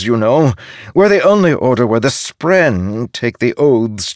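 high, loud, troubled spirit voice; earnest, overwrought young spirit, pitched well up; distressed novice spren. Acted. Highspren pours out his sense of failure.

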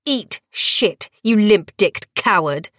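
put-on bloodied girl shouting defiance at her captor; defiant yell. A narrator's reading. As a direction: fling the insult at him. Dior spits defiance at captor.

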